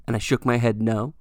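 normal talking voice

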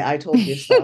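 said gently through a laugh; gentle told-you-so. Retold or imitated. Mentor teasing her former doubter, gently laughing. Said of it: "Veronica did very gently say".

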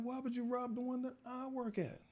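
high, sustained exasperated thought; inner outrage. Retold or imitated. Employee fuming inwardly at the robbery, high and exasperated. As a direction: fume silently at the friend. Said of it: "in my mind, I'm like".

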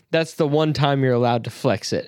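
ordinary talk

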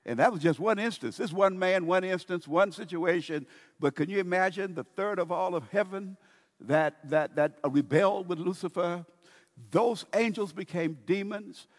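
loud, high, driving sermon; preaching at full force. Real, not acted. Preacher driving home the fall of Lucifer's angels, loud and high. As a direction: build and push it to the congregation.

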